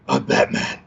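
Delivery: hushed mock superhero voice; Batman. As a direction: imitate Batman with a sick voice, quietly. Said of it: "my voice had this deep booming".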